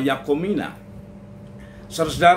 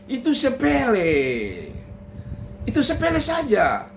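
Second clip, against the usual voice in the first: high, airy wife brushing it off; breezy wife; 6 semitones higher than usual. Acted. Wife Yakomina waving off her husband's praise, high and breezy. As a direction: toss it off lightly, high and easy.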